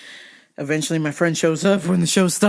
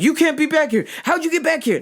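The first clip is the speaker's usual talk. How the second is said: mock guard yell; 10 semitones higher than usual. Guards yelling at him.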